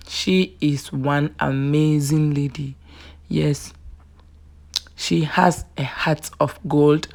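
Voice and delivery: ordinary talk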